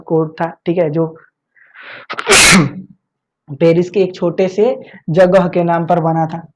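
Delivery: ordinary talk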